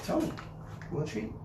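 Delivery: whispered treat tease; whispered coax. Real, not acted. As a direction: breathe the question to hype him. Coaxing dog to speak.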